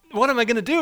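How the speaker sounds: high, loud frantic cry; conflicted exasperation. Retold or imitated. Stuck at broken light, driver cries out in frantic frustration. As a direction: throw up your hands in desperation.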